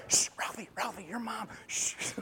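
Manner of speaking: urgent shushing whisper; whispered warning. Retold or imitated. Kid warning Ralphie his mom is coming, whispered. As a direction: whisper it to hush a friend.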